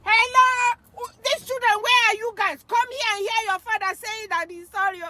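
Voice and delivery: high-pitched summoning call, re-enacted; calling children over.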